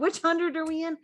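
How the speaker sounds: high, loud quoted question. Retold or imitated. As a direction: pop up high asking it. Quoting themselves checking subscriber count.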